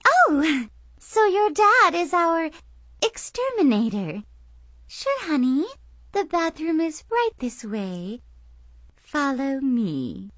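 high, sugary forced politeness; strained fake-sweet lady. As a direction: smile through gritted teeth. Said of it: "strained smile".

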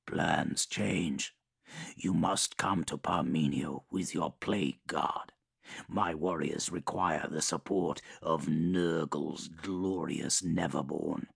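measured, menacing warlord voice; Mortarion voice. Acted. Mortarion orders.

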